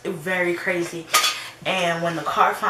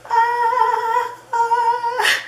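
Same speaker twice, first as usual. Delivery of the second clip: much higher, louder, held heavenly note; God's voice; 8 dB louder than usual. Pretend. God announcing new friends with a heavenly ah. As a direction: sing it out like a choir of angels. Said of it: "It's like God was like".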